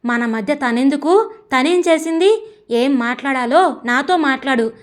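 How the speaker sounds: ordinary delivery, nothing marked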